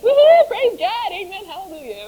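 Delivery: loud, high cheering that settles by the end; joyful praise shout. Retold or imitated. Thanking God for the food, an exultant shout fading. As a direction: shout your praise then ease down. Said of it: "I was just like".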